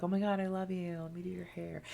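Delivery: lightly voiced doting little girls, quiet; affectionate little girls. Twins playing with people's hair to show affection, soft imitated gush. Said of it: "that's, like, how they were like".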